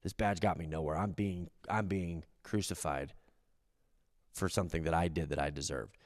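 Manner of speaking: very low, soft broken confession; hushed repentant voice. Retold or imitated. Repentant thief admits guilt, very low. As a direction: speak it low like a man on a cross. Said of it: "the other guy goes".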